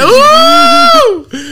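long, very high drawn-out wow; stunned wow. Retold or imitated. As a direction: stretch a shocked wow way up. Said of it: "ואני כזה".

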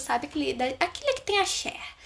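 ordinary delivery

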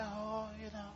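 mock timid shrug. Mocking a timid believer.

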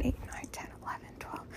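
whispered count to oneself; counting under her breath. Real, not acted. Patient working out her surgery hours, whispered counting. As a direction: whisper the numbers, barely audible.

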